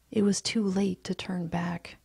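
ordinary delivery, nothing marked

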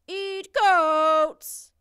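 huge, high, drawn-out monster bellow; monster holler. A narrator's reading. Big blue monster insists on its meal, hollering. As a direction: holler it like an enraged giant beast. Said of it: "hollered the big blue monster".

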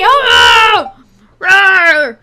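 high, loud hammed-up pirate roar; over-the-top pirate outcry. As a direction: bellow like a cartoon pirate. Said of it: "give him a pirate accent now".

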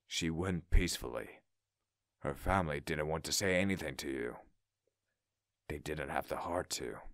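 low, hushed, sorrowful consoling; gentle condolence. A narrator's reading. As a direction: console softly with long pauses. Gently breaks news of a death.

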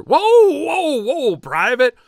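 high, loud whoa, stopping him; loud incredulous drill sergeant; drill sergeant holding back laughter. Acted. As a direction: stop the private, suppressing a laugh. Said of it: "trying to hold back laughter".

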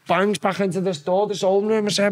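ordinary talk, nothing marked

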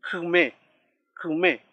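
Kume, kume with the first vowel sunk into the K; vowel after consonant. Shown as a demonstration. Teacher shows the vowel taking the K's sound, careful model of Kume, kume. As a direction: model Kume, kume separately, stress on the last syllable. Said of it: "toma ese sonido".

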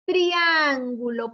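slow, stretched TRIÁNGULO with a long stressed A; drawn-out model word. Shown as a demonstration. Teacher modelling a word with tri for pupils, slowly drawn out. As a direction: sound out "Triángulo" slowly and firmly.

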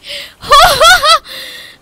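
shrieking laugh half crying; loud high cackle of disbelief; hysterical laugh. Real, not acted. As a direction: laugh out in shocked joy. Stunned by an amazing run.

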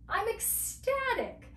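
high-pitched delighted exclamation; acted ecstatic. Shown as a demonstration. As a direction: sell the thrill, pitch leaping up. Demonstrating the phrase with feeling.

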